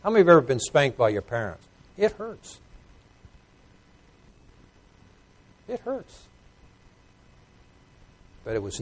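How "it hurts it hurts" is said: very soft, high-pitched; soft, high little ouch; hushed pained aside. Acted. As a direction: say it small and high, like a smarting child. Recalls the sting of spanking.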